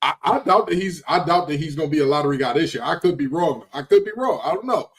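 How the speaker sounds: usual speaking voice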